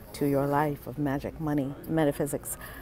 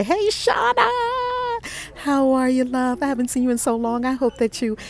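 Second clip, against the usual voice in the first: much higher, louder, fast hello; thrilled hello; 7 semitones higher, 3 dB louder than usual. Real, not acted. Host greeting longtime viewer Shauna, thrilled high hello.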